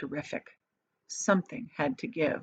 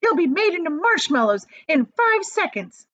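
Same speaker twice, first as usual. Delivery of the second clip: angry; 10 semitones higher, 5 dB louder than usual. A narrator's reading. Angry mother continues.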